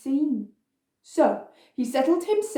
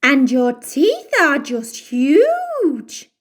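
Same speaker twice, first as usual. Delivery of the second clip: exclaimed; 3 semitones higher, 4 dB louder, slower than usual. Acted.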